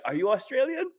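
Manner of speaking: high, loud mock-customer question; curious questioning. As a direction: imitate an intrigued customer, pitch shooting up.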